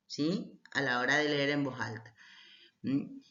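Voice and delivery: normal talking voice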